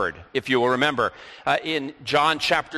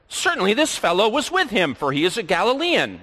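accusing; 7 semitones higher than usual. Acted. Bystander accuses Peter.

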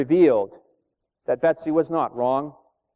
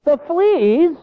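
much higher, louder disbelieving question; incredulous; 12 semitones higher, 6 dB louder than usual. Retold or imitated. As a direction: balk at the absurd idea, rising. Corrie disbelieving her sister.

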